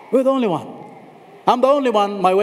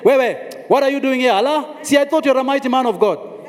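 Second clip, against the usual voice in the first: fast, high-pitched telling-off; mock scolding; 6 semitones higher than usual. Scolding a fallen mighty man of God, fast and high. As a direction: rattle it off as a mocking reproach. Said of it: "he didn't say".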